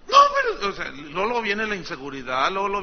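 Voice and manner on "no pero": protesting congregant. Acted. Congregant voiced protesting pastor.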